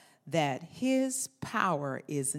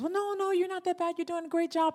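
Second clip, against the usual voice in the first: much higher, quick chirpy reassurance; mock reassurer; 13 semitones higher, 3 dB louder, faster than usual. Friend lifting up someone who feels a failure, high and quick. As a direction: imitate a friend brushing it off cheerfully. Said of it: "a response from someone else that says".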